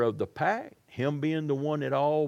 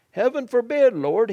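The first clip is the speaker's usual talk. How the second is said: much higher and louder cry of protest; alarmed protest; 8 semitones higher, 9 dB louder than usual. Retold or imitated. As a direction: cry out to stop him. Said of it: "began to reprimand him".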